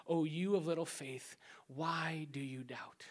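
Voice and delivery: soft, low whisper of reproach; gentle hushed rebuke. Retold or imitated. Jesus speaking softly to sinking Peter, whispered. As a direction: breathe it out tenderly. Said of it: "saying to him".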